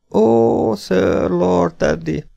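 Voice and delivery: high, drawn-out feeble groan. A narrator's reading. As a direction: groan it out slowly as an injured man. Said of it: "nyögte a félvér".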